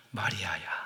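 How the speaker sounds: soft, low, tender call by name; familiar gentle call. Acted. Jesus calling Mary in His familiar voice, soft and low. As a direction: call softly to someone who knows your voice. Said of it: "익숙한 소리로 이렇게 부르셔요".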